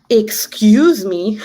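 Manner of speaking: slow, drawn-out offended excuse me; indignant excuse me. Retold or imitated. To the jogger who cursed at her, slow indignant disbelief. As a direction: stretch it out, daring her to repeat it. Retold reaction to insult.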